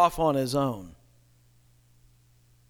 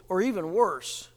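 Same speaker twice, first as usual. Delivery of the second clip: normal talking voice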